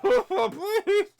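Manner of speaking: high, loud laughing plea; begging. Acted. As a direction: beg for mercy through laughter. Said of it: "he's like".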